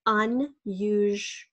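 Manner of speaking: "Unusual" slowly broken into its parts; syllable by syllable. Shown as a demonstration. Teacher sounding out unusual for spelling, slow syllable by syllable.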